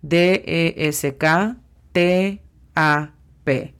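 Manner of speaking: D E S K T A P spelled out slowly, letter by letter. Shown as a demonstration. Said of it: "La pronunciación no cambia". (deletreo lento, letra por letra)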